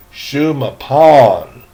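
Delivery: "Shumapon" said slowly, syllable by syllable; careful pronunciation. Shown as a demonstration. Teacher sounding out "Shumapon" for the class, slowly. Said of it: "Is that how you pronounce it?".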